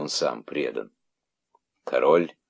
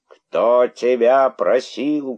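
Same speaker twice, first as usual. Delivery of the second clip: slow, loud, high jeer; indignant crowd shout; 6 semitones higher, 6 dB louder, much slower than usual. A narrator's reading. Crowd turning on the herald, indignant shout. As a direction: shout it slowly in outrage. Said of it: "кричала возмущённая толпа".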